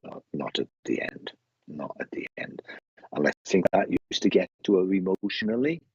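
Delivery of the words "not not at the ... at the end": low, near-whispered repetition; hushed. Retold or imitated. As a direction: let the voice sink softly. Relaying her sad admission.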